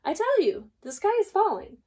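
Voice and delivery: panicked birds urging; frantic insisting. Acted. Hen insists.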